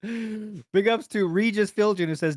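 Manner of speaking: ordinary talk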